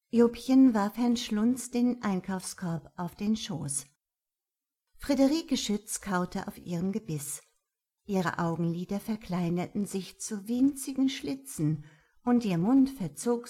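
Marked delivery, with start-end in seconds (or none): none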